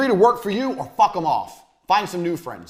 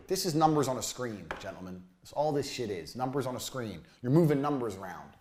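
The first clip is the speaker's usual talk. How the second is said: ordinary delivery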